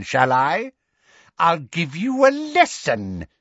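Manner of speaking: slow, drawn-out sinister lecture; menacing villainess. A narrator's reading. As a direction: savor each word as you threaten. Bellatrix lectures Harry, baby voice abandoned.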